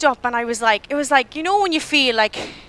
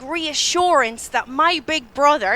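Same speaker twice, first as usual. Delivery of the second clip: ordinary talk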